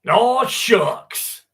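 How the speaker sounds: high, loud Southern oh shucks; a hammed-up country exclamation; Southern accent. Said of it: "Try your best Southern dialect or accent".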